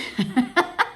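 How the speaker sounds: high, rising burst of laughter; real laugh. Real, not acted. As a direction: laugh at your own joke. Laughter after her joke.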